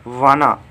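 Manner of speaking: वाना said as one blended word, wanna. Shown as a demonstration. Teacher announcing the new word to the class as वाना. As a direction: want to squeezed into वाना. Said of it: "want to को हम short में वाना लिख सकते हैं".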